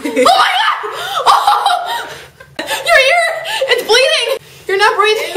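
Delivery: high, loud, laughing panic; panicked laughing shout. Acted. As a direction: shriek in mock alarm.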